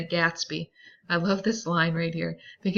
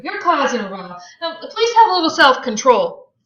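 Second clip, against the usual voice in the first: higher, louder pleading; desperate; 8 semitones higher, 9 dB louder than usual. Acted. Daisy pleads with Tom to calm down, high and loud. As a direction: beg him to stop, rising. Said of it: "Daisy looked desperately".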